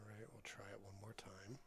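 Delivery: soft whisper. Real, not acted. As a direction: whisper it to yourself. Commentating quietly on stream.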